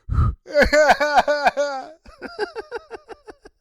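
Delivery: high, drawn-out fake wail; hammed-up crying fit; mock sobbing. Acting tearful runner.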